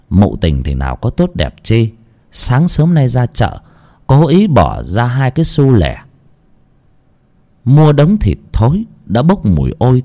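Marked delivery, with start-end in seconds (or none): none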